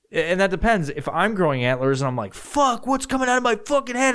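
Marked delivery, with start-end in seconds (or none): 2.3-4.1 high alarmed squeal; high panicked yelp. Pretend. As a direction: yelp it in horrified confusion. Panicking at antlers.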